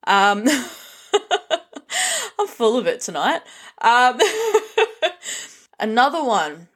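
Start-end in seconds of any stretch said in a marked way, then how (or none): 1.1-2.3 a burst of high laughter. Real, not acted. Laughs at own joke. 4.2-5.4 another high giggle; high laugh. Real, not acted. Laughs again.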